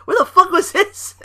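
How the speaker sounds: retold shocked squeak; amazed. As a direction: squeal it out in mock disbelief. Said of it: "I'm like".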